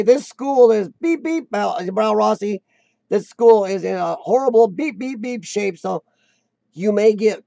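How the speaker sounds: ordinary delivery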